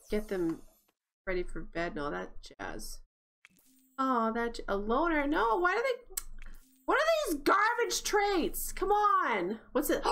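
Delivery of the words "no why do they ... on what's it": exasperated. Real, not acted. Player protests the Sim's garbage traits, exasperated. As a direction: protest in high-pitched exasperation. Complains about the random traits.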